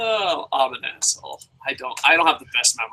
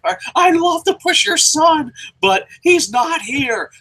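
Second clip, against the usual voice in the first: higher, louder pleading; a whiny Vince; 14 semitones higher, 4 dB louder than usual. Retold or imitated. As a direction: play the excuse-making boss. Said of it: "Be like".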